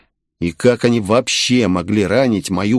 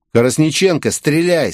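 higher, firm commanding voice; stern order; 6 semitones higher than usual. A narrator's reading. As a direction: give the order strictly, as her commander. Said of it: "строго произнёс я". (строгий командирский приказ)

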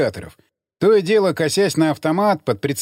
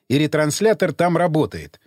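ordinary delivery, nothing marked

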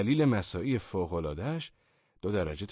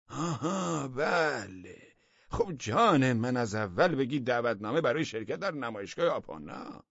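minister suddenly catching on; 6 semitones higher than usual. A narrator's reading. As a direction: stretch it out as the penny drops. (آهانِ کشدار و زیرِ وزیر)

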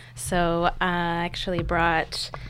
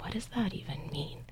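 whispering; 10 dB quieter than usual. Retold or imitated. Inner voice recalled, whispered.

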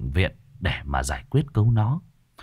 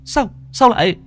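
stunned confusion; 8 semitones higher, faster than usual. A narrator's reading. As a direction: stammer in bewildered shock. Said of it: "Anh kinh ngạc nói như không hiểu". (giọng kinh ngạc lắp bắp)

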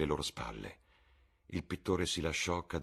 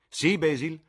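much higher, louder, delighted invitation; exuberant Lord Henry; 13 semitones higher, 10 dB louder than usual. Acted. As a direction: sweep them along gleefully. (Lord Henry esuberante, molto più alto e forte)

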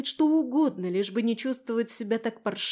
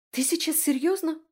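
high, incredulous question; indignant; 8 semitones higher, 3 dB louder than usual. A narrator's reading. Driver can't believe her friend's silly question, indignant. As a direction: push the pitch up in indignation. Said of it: "возмутилась я".

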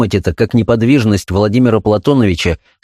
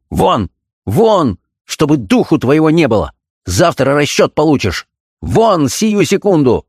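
higher-pitched angry ordering out; enraged dismissal; 6 semitones higher than usual. A narrator's reading. Doctor sends his servant packing, angry and high. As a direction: throw the servant out in anger, pitch raised. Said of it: "увидал своего барина таким обозлённым".

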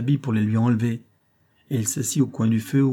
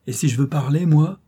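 louder, higher, rising to an insistent cry on moi; energetic outcry; 6 semitones higher, 6 dB louder than usual. A narrator's reading. Dying man insists to Robert on speaking, loud and high. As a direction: push back hard, demanding to be heard. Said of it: "s'écria Luke avec énergie".

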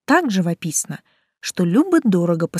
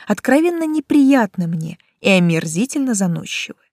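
ordinary delivery

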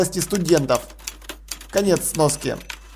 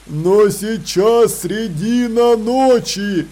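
slow troll voice; 2 semitones higher, much slower than usual. Acted. Troll Detritus objects.

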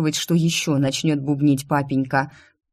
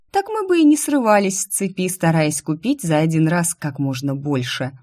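ordinary delivery, nothing marked

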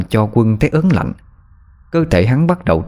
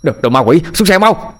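terrified yell, rising sharply; shouting; 8 semitones higher than usual. A narrator's reading. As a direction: yell at her to get off. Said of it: "quát lên".

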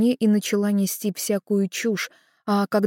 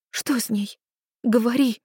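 хриплый сдавленный голос; прохрипеть, требуя ответа; хрипло; 5 semitones higher than usual. A narrator's reading. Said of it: "прохрипела я". (хриплым голосом)

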